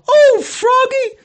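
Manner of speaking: high falsetto yell, drawn out; Missus Pillsbury. Retold or imitated. Guest realizes what she'd done, high dismayed yell. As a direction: wail it in dismay, very high and slow. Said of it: "she'd yell".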